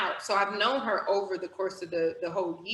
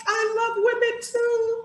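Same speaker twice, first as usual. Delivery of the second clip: high, slow, quoted tearful coach; tearful confession; 14 semitones higher, 3 dB louder than usual. Retold or imitated. Crying coach confessing while hugging, high slow voice. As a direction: let it out slowly, high and teary. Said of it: "she was like".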